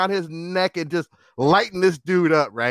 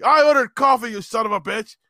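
high, louder indignant outburst, acted; angry drunk customer; 6 semitones higher, 3 dB louder than usual. Drunk customer cursing at the teenage clerk, angry outburst. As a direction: imitate an irate drunk, pitched up.

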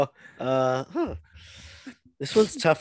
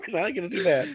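laughing; 6 semitones higher, faster than usual. Real, not acted. Laughing hard.

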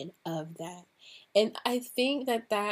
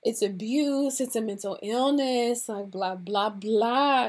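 louder, dismissive mock of the naysayers; mocking critics; 2 semitones higher, 6 dB louder than usual. To viewers, mimicking people who judge kink, mocking and louder. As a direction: parrot the critics with a scoff. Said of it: "People are gonna think that".

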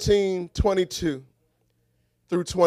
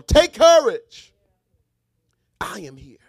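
emphatic reassurance; 6 dB louder than usual. Shown as a demonstration. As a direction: lean on it for someone in a fight. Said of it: "say it one more time for the person".

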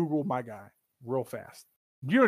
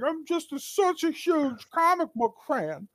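mocking comic fan voice; 12 semitones higher than usual. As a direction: sneer at the self-proclaimed superfan. Mocking friends' self-description.

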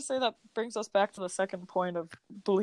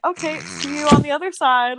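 excited; 7 semitones higher, 6 dB louder than usual. Retold or imitated. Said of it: "we'd be like".

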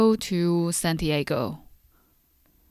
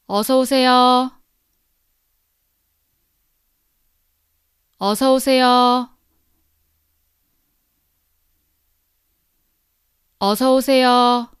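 "어서 오세요" run together quickly and loud; fast and natural; 5 semitones higher, 7 dB louder, much faster than usual. Shown as a demonstration. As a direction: rattle off "어서 오세요" at natural speed. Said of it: "Now faster, three times".

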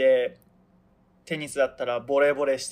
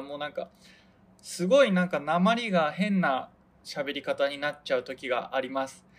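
ordinary delivery

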